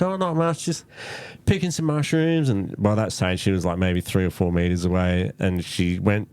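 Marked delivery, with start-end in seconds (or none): 0.0-2.5 mock innocent reply. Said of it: "and like".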